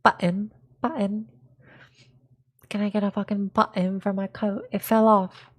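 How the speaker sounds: mock British accent with swallowed Ts; hammed-up British voice. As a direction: put on a cheeky Brit asking a favour. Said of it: "unless they're British".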